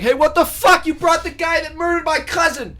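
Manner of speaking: high, hammed-up angry yell; acted outrage. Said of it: "somebody would be like".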